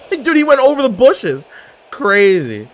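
high, loud amazed shout; stunned gamer yelling at a wild move. Real, not acted. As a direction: blurt it out in disbelief. Amazed at enemy jumping bushes.

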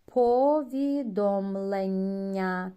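Повідомлення slow and loud with a long soft лл; slow model word. Shown as a demonstration. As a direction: stretch the лл in Повідомлення. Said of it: "попередній звук пом'якшує".

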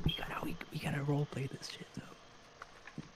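unvoiced rushed whisper. Real, not acted. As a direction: whisper fast while hiding. Hiding from monster, hushed.

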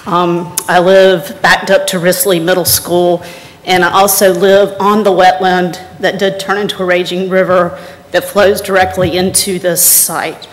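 ordinary talk

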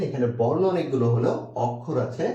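ordinary delivery

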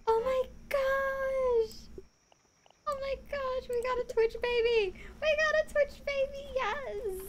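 high, loud squeal with a breaking voice; voice cracking. Real, not acted. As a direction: voice cracks with excitement. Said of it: "My voice is cracking so bad right now".

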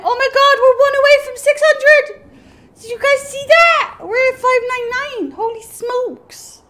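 high, loud excited outburst; real excitement. Real, not acted. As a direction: exclaim it high and loud, thrilled at nearly making it. One short of milestone.

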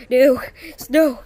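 loud, urgent cries of no; loud panicked protest; panicked shouting. Real, not acted. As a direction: shout each no in alarm. Panicking mid game.

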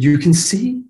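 can't believe what he's hearing; retold gasp of disbelief; astonished question. Father stunned son regained sight.

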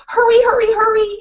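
high, pressing dog voice; hurrying dog; urgent dog barking. Acted. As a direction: bark urgently.

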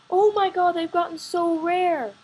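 sudden high, loud oh my god; excited outcry. Real, not acted. Gamer telling viewers about trades, excited outcry. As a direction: blurt it out, genuinely taken aback. Shock at rare item prices.

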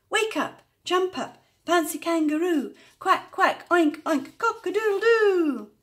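very high, loud, quick calling; excited wake-up call. A narrator's reading. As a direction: burst into frantic farmyard cries. Rousing animals again, faster round.

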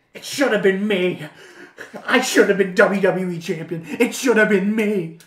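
loud, hammed-up wrestler rant; Dolph Ziggler. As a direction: shout it like an aggrieved wrestler, spitting the words. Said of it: "He's like".

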